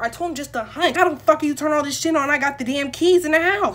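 normal talking voice

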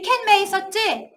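ordinary talk, nothing marked

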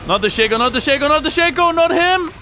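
high, loud pleas climbing in pitch; beg, rising to a shriek; panicked. Real, not acted. Streamer as Shaco jumps him, rising panicked yelling.

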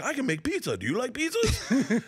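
sing-song it silly; mock cheerful gibberish translation; goofy high voice. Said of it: "it doesn't look like".

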